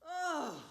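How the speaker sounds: weary groan. Acted.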